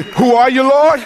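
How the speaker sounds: high, loud crying-out prayer; loud cry to the Lord. Shown as a demonstration. Pastor leading the congregation in a high, loud cry to the Lord. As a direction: call out to God with everything.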